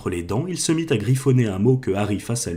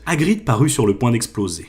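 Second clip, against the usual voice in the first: plain reading voice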